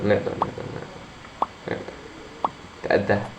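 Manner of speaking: soft, breathy whisper to yourself; whispered self-encouragement. Real, not acted. Gamer whispering encouragement to himself before a hard jump. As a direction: whisper it to steady your nerves.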